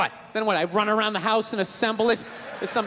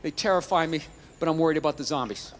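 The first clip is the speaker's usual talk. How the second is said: low, subdued confession; honest admission; 7 semitones lower, 4 dB quieter than usual. Retold or imitated. Comic admits ignorance to gun seller, low honest confession. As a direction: own up to it, pitched low. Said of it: "I'm gonna go with honesty".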